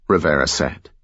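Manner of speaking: usual speaking voice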